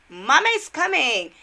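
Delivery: high, loud motherly call; hammed-up rescuing-mama shout. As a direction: sing out loudly to an injured child. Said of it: "bolded with exclamation mark".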